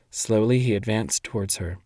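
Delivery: usual speaking voice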